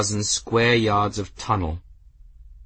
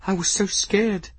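high, tearful and breaking; crying; 11 semitones higher than usual. Acted. Bridget crying to the cat, high and tearful. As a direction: let the fear spill out in tears. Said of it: "she did begin to cry".

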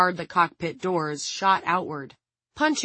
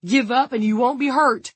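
raised, high-pitched shout; 6 semitones higher, 6 dB louder than usual. A narrator's reading. Frank urging the guard to give up, shouting. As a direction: shout a demand to surrender. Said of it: "Frank shouted".

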